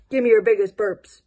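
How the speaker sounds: usual speaking voice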